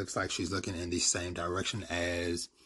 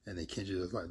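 usual speaking voice

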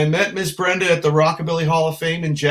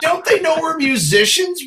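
much higher mock outrage; mock indignant; 13 semitones higher than usual. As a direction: squeal it like a joke complaint. Retold joking complaint, high.

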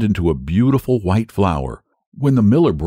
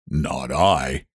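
much lower, softer piggy refusal; lazy pig; 8 semitones lower, 5 dB quieter than usual. Acted. Pig refusing the hen, low soft grumble. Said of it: "The pig said".

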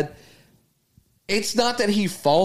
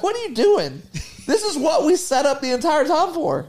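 incredulous what; 7 semitones higher than usual. Retold or imitated. Son reacting to dad leaving.

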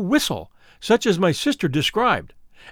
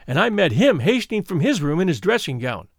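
ordinary delivery

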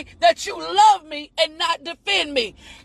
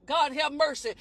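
softer, lower aside to God; muttered aside; 3 semitones lower, 9 dB quieter than usual. Real, not acted. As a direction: drop it under your breath.

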